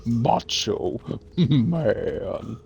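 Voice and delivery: drawn-out, hammed-up boxer boast cracking with laughter; Super Macho Man. Co-host imitates a Punch-Out boxer, hammed-up boast. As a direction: stretch each word like a showboating boxer. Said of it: "Super Macho Man from Punch-Out".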